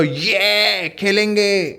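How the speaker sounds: put-on prompting child. Shown as a demonstration. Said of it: "बोलो".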